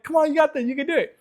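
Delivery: put-on high, bright cheer for a small child; encouraging a child. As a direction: lift her up with a high, cheering voice. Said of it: "I was like".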